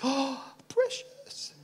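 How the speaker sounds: gasping, high, slow croon over a treasure; gasp, then softly covet it in a put-on voice; hammed-up, soft covetous creature; possessive creature voice. Acted clinging to his precious.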